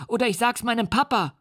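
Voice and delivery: put-on high, terrified little boy's voice; screaming child. A narrator's reading. The boy shrieks insults at the monster, high and frightened. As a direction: cry out in a child's panic. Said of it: "schrie er".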